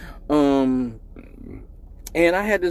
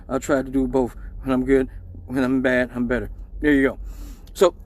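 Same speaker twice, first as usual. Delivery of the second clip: ordinary talk, nothing marked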